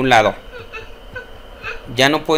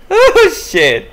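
startled gasp; 4 dB louder than usual. Real, not acted. Scared reaction.

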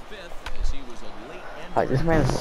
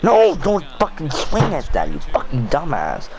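high-pitched outburst of real frustration; exasperated yell that drops at the end; angry at himself; 8 semitones higher, 3 dB louder than usual. Real, not acted. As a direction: cry out at your own blunder, pitch way up. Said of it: "I'm getting so angry at myself".

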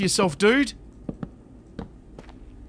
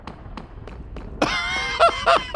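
squeaky laugh, pitched way up; high burst of laughter; 3 dB quieter than usual. Real, not acted. Streamer reading a silly chat comment, squealing with laughter. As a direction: let out a high giggle at the joke.

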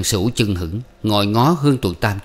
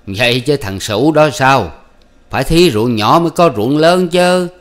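higher, louder, slow mocking elder's voice; teasing village elder; 5 semitones higher, 6 dB louder than usual. Old official teasing Xuyên about his wife, mocking. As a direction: voice a sly old man needling the young farmer.

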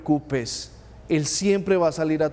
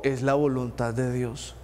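lower, soft, resigned sigh of fatalism; resigned refrain; 6 semitones lower, 6 dB quieter than usual. Retold or imitated. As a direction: drop the voice like a shrugging believer.

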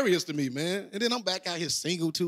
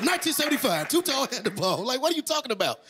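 ordinary talk

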